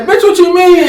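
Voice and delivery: high-pitched pretend outrage; mock outburst. Man imagining snapping at girlfriend's friend, high mock outrage.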